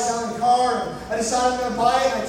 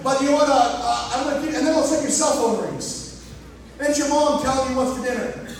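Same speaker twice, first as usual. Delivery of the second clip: ordinary talk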